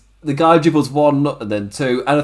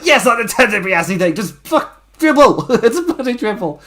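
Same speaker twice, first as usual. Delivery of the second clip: hammed-up high, exasperated yelling, building louder; frustrated mock shout; 8 semitones higher, 3 dB louder than usual. Retelling the wrestler demanding a dribble, exasperated mock shouting. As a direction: imitate an angry man losing it at a bystander. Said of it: "he's absolutely frustrated".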